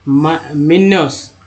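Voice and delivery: मिनस spelt out slowly, syllable by syllable; slow sounding out. Shown as a demonstration. Teacher showing students how to read, slowly sounding out. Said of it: "मिनस के बाद है दोस्तों मिनो".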